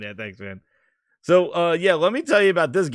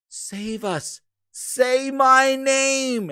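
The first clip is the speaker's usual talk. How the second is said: high, very slow, drawn-out plea; hammed-up desperate ghost begging; mock pleading Bootleg Joel; 10 semitones higher, 4 dB louder, much slower than usual. Bootleg Joel begs Master Chief to say his name, high hammed-up plea. Acting out leaked Halo ending.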